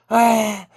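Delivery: father's shocked cry. Retold or imitated. Father sees croc take son.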